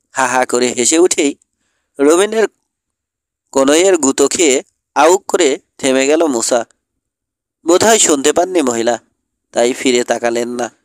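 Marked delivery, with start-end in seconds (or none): none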